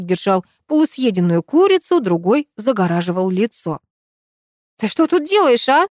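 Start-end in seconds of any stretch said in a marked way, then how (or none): 4.8-5.9 high, sharp angry demand; angry challenge to an intruder; bark. A narrator's reading. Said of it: "рявкнула я".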